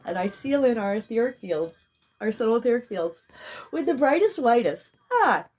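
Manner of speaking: rising, fervent ritual declaration; healing invocation. Real, not acted. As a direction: call in the light with fervor. Spiritual healing decree.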